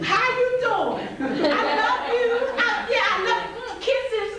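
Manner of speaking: high, laughing mock sweet-talk; mocking sweet talker. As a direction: ham up the smooth flirt, giggling. Mocking a smooth-talking man.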